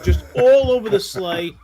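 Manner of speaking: ordinary talk, nothing marked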